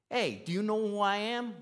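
brash New Yorker, Paul puffing himself up; New York accent. Pretend. Pastor voicing Paul as a pushy New Yorker. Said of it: "Paul has a New York accent".

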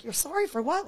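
high-pitched, laughing bewilderment; retold bafflement. Reader answering the weeping reverend, baffled. Teller voices own baffled reply.

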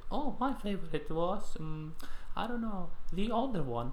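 high put-on voice of a friend, airy and unsure; imitating Marta. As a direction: mimic the friend, pitch up high. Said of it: "Marta will say".

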